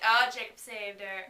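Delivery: usual speaking voice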